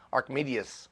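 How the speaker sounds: "Archimedes" said as ark-MEE-dee-us; mispronounced Archimedes. Retold or imitated. Speaker confesses his old mistake, "Archimedes" as ark-MEE-dee-us. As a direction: say "Archimedes" wrongly, ending in dee-us. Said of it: "I think I was saying".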